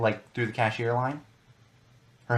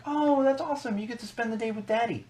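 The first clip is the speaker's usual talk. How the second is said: imitating a cooing older cashier, much higher; sweet cashier voice; 12 semitones higher than usual. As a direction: mimic a gushing checkout lady talking to a toddler, pitched way up. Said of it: "She's like".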